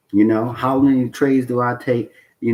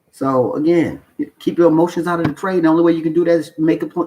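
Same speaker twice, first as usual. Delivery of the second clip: ordinary talk, nothing marked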